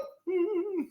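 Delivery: high, sustained hum; hummed pitch note. Shown as a demonstration. Vlogger giving the note for perfect intonation, high hum. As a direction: give the singer a high note. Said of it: "Here's your note".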